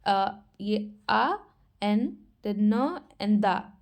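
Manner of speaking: slow, syllable by syllable, each sound held apart. Shown as a demonstration. Teacher showing students how to read it, slowly sounding out. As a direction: spell the word out slowly for learners. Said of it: "इसको हम कैसे पढ़ेंगे?".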